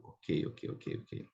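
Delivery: breathy whisper, aside to herself. Real, not acted. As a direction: murmur the okays under your breath. (sussurrado para si mesma)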